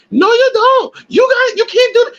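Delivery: imitated angry little girl, very high and squeaky; mock a shrill, upset child in a tiny squeak; put-on high-pitched squeal of protest; Elena's high squeaky voice. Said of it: "Real high-pitched, squeaky voice".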